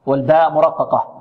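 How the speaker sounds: ordinary delivery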